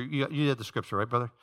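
ordinary talk

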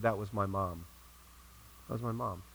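put-on low, soft, unemotional retelling of a child's remark; understated and matter-of-fact, voice dropped low. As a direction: say it quietly, as a simple fact. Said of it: "Matter-of-factly".

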